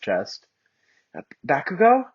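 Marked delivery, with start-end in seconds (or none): 1.2-2.0 high, startled question; girl startled by Bakugo's move. Acted. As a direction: blurt out his name in surprise. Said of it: "startled and surprised".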